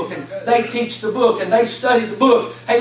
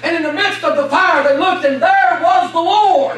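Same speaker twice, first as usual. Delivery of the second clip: preacher thundering out the fiery furnace story; fervent preaching; 5 semitones higher than usual. Acted. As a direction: build the Bible story to a shouted peak.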